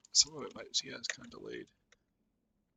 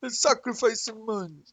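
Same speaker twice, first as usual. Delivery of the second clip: higher mock Jamaican troll chant; hammed-up Jamaican accent, playful; 8 semitones higher, 7 dB louder, slower than usual. Imitating trolls' role play for a friend, mock Jamaican accent. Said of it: "they're all doing Jamaican accents".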